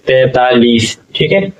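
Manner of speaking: normal talking voice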